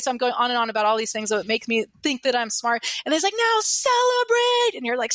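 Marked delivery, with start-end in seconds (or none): 3.4-4.8 very high, louder, drawn-out call; slow, much higher triumphant call to celebrate; excited celebrate call. Retold or imitated. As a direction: stretch it out to the crowd like a hype host. Seminar leader calling for celebration.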